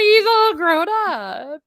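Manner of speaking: high, loud, drawn-out cooing; hammed-up proud squeal; mock gushing. Mock gushing over grown-up Henry.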